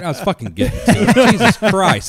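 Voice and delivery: fast, loud, high laughing complaint; laughing protest. Real, not acted. Storyteller annoyed at the spoiler, laughing. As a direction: laugh it off, mock-offended.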